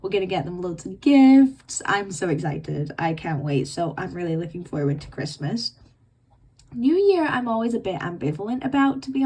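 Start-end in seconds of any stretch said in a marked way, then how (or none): none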